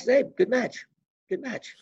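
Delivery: high, then fading offhand praise; a hint of a grudging coach; curt praise. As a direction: brush it off like a gruff coach. Gable's curt praise retold.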